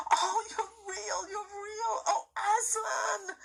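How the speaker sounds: high, tearful delight; weeping for joy, high; joyful crying out. A narrator's reading. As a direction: cry out overjoyed. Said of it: "cried Lucy".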